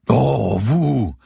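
scandalized concierge; indignant exclamation. A narrator's reading. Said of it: "s'exclama-t-elle en marquant son indignation". (exclamation indignée, aiguë et forte)

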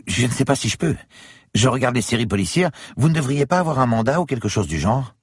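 a nervous concierge hedging. Acted. Concierge character voice. (voix de concierge hésitante)

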